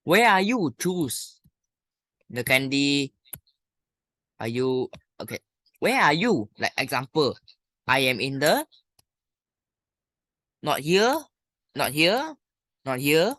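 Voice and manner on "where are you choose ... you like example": ordinary delivery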